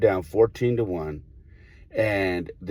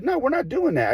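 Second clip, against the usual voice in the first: much higher, emphatic flat no; firm refusal. Retold or imitated. Coach refusing the umpire, high and emphatic. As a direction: shut it down hard.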